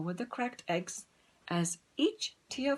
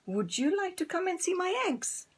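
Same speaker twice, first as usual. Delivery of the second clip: much higher, eager hen asking; 11 semitones higher than usual. Acted. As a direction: ask proudly in a high hen voice. Dora voiced in story.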